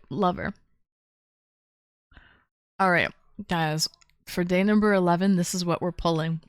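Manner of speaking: ordinary talk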